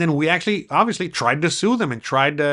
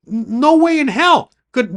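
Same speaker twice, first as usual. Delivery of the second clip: emphatic insistence; 9 semitones higher, 6 dB louder, slower than usual. Real, not acted. Insisting about freedom in Mexico.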